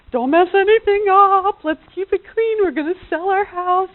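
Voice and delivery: high, loud mom warning; warning the kids. Retold or imitated. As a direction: keep the kids from making a mess. Mom warning kids keep clean.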